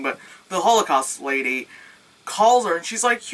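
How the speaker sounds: ordinary talk, nothing marked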